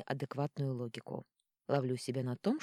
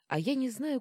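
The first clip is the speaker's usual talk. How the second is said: usual speaking voice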